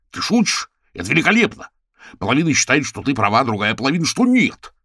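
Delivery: high, thrilled editor; excited. Acted. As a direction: burst with glee. (восторженно)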